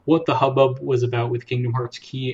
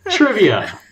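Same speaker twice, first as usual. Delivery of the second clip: announcer; 8 semitones higher, 6 dB louder, slower than usual. Acted. Announcing quiz segment.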